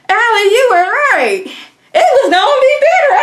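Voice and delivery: high, loud giddy voice breaking into a laugh; mock gleeful woman. Beverly gloats to Allie, high giddy laughing voice. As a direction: play her as giddy and delighted. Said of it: "And she's like".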